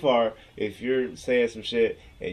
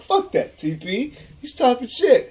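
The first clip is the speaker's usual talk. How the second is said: high, loud riled-up yell; mock yelling; 12 semitones higher, 7 dB louder, slower than usual. Jay shouting about the rival fan, hammed-up yell. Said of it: "He's like".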